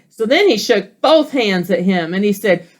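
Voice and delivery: plain reading voice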